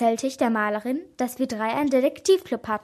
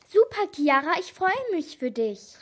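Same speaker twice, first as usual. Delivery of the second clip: higher-pitched, happy congratulation; delighted exclamation; 6 semitones higher, 4 dB louder than usual. A narrator's reading. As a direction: call out proudly. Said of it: "rief meine Mutter". (erfreut ausrufen)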